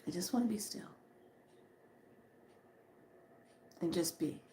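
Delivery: hushed whisper of longing for stillness. Retold or imitated. Texting a friend she needs rest, whispered. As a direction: whisper it as if to yourself.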